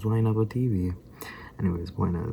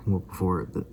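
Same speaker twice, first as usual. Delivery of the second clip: normal talking voice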